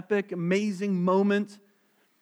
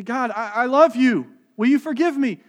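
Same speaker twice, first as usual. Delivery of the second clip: pleading believer; 6 semitones higher, 9 dB louder than usual. Retold or imitated.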